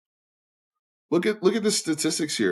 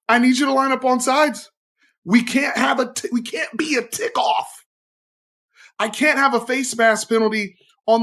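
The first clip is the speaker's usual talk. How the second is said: raised, higher insistent call for discipline; emphatic demand; 7 semitones higher than usual. Real, not acted. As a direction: bark it like a fed-up coach.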